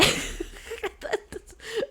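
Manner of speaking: breathy giggle up high; high airy laugh. Real, not acted. Teller giggling about the jump, high airy laugh. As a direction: giggle at the silly twist.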